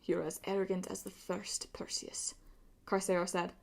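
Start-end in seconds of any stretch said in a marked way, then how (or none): 0.0-2.3 soft snarl fading to a whisper; restrained snarl. A narrator's reading. Pirate rebukes Percy for mocking his mother, quiet snarl. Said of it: "he was now snarling under the mask".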